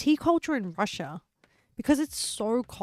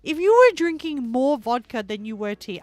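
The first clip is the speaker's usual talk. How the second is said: louder, higher mock outrage, slowing at the start; hammed-up disbelief at the vodka statistic; exasperated to chat; 4 semitones higher, 6 dB louder than usual. Streamer to chat about tea and vodka statistics, loud mock outrage. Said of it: "But I'm like".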